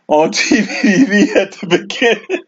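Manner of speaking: chuckling through the words; real laughter bubbling into the line; laughing. Real, not acted. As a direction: read on while breaking up. Laughs while reading comment.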